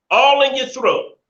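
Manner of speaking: high, slow mock quote of the song line; mocking the singer. Vlogger mocking the rapper's lyric to his viewers, high and drawn out. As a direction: sneer it in a high, drawn-out imitation. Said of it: "she says".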